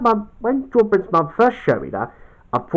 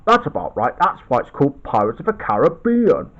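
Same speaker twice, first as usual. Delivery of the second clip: usual speaking voice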